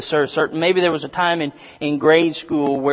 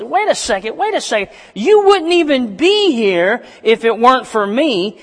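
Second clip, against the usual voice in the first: high, loud, rising protest; hammed-up indignant whine, high and loud; upset pleading; 10 semitones higher, 3 dB louder than usual. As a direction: protest high and hurt, like a let-down boy. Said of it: "he's upset".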